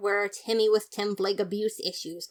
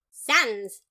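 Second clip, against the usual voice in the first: sharp call; 2 semitones higher, 7 dB louder than usual. Acted. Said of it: "I said, unable to watch".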